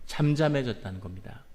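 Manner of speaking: normal talking voice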